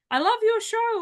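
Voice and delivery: high-pitched, fawning fan imitation; butter them up in a put-on high voice; mock gushing. Mimicking fake flattering guest pitches.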